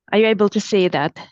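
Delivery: ordinary talk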